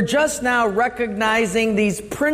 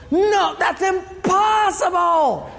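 much higher, slow, drawn-out no; exasperated shout; 10 semitones higher, slower than usual. Retold or imitated. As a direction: cry out in total disbelief. Time traveler refuses flatly.

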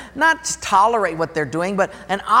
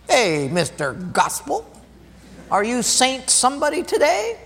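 higher, mocking taunt; mocking cantankerous guy; 6 semitones higher than usual. Cantankerous school administrator taunting the youth pastor, mocking. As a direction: tease the preacher with a sneer. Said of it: "he'd call me Mister Gospel".

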